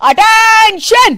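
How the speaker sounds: high, drawn-out slow parade-ground shout; mother-in-law calling the house to attention; drill command. Acted. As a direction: bark it like a drill sergeant. Retired policewoman barks command.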